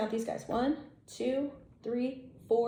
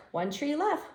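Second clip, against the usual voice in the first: plain reading voice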